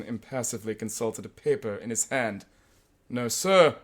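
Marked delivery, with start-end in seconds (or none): 3.1-3.8 civil. Retold or imitated. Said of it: "he said civilly".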